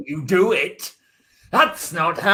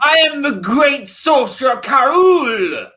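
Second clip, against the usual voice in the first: loud, higher booming sorcerer boast; megalomaniac rant; 5 semitones higher, 3 dB louder than usual. Acted. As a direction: proclaim yourself a mighty sorcerer. Sorcerer proclaims himself.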